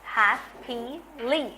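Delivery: Happily slowed into three beats, hap-pi-ly; slow, spaced hap-pi-ly; syllable beats. Shown as a demonstration. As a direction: clap out hap-pi-ly slowly. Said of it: "three syllable".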